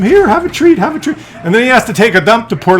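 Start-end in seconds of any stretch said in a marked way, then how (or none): 0.0-1.2 much higher, coaxing treat voice; sweet talk to dog. Retold or imitated. Owner offering puppy treats, high coaxing sing-song. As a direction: coo at the puppy like you spoil him. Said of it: "you're so nice to him".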